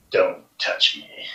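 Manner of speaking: slow, whispered warning; cold dragon whisper. Acted. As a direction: hiss it slowly, coldly. Dragon snapping at a human.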